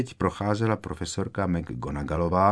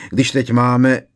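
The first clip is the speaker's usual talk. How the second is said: louder start, suddenly cut short; breaking off abruptly; 4 semitones higher, 7 dB louder, slower than usual. A narrator's reading. George catching Harry's warning look, breaking off abruptly. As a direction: stop yourself mid-sentence. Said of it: "zarazil se".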